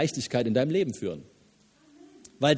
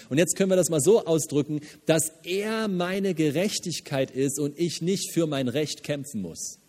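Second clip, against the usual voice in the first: normal talking voice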